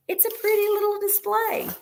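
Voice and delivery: whisper. Real, not acted. Seller admiring the flower display in a high whisper. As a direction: breathe it out admiringly.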